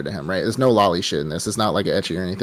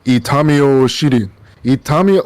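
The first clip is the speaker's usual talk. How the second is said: Pain's voice; 3 semitones higher, 5 dB louder than usual. Retold or imitated. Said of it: "straight up Pain voice".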